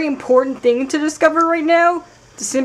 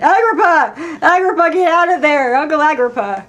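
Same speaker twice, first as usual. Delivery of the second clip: louder, higher urgent call; 4 semitones higher, 4 dB louder than usual. Real, not acted. Player shouting for Uncle Agrippa, louder and urgent. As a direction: shout the name across the room.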